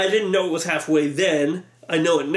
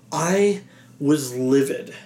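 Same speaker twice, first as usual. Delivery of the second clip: slow emphasis; 4 semitones lower, slower than usual. Real, not acted. Emphatic statement of past anger.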